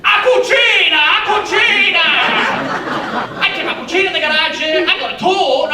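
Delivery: very high, loud Italian mum; mum shouting. Retold or imitated. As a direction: shriek it like an excited Italian mother. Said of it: "my mum would go".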